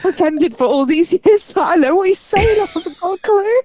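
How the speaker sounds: high, laughing confession. Real, not acted. Admitting she never understood him, laughing high. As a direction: confess it while still giggling.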